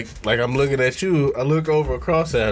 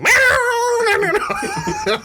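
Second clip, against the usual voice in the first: high burst of laughter. Real, not acted. Laughing hard at story.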